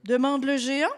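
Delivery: ordinary delivery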